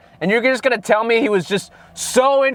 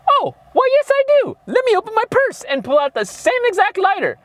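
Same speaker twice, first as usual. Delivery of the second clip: high falsetto imitation of her reply; mock prim woman. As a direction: put on a squeaky-high woman's voice, hammed-up.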